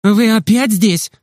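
mildly cross, high and slow; understated annoyance of a stern nurse; angry nurse. A narrator's reading. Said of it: "сердитая медсестра кричала".

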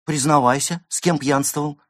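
high, loud interrogation; indignant demand. Acted. Friend questioning the drunken cat, indignant. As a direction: demand a confession, scandalised.

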